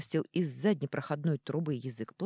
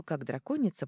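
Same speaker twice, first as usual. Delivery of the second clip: ordinary delivery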